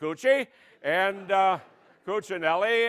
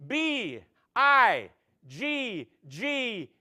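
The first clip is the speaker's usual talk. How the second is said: contestant spelling; much slower than usual. Pretend. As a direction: spell it out slow then crack up.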